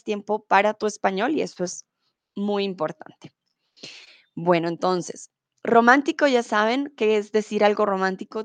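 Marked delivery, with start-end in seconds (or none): none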